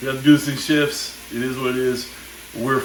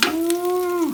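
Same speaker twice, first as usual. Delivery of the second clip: excited. Real, not acted. Admiring sear marks.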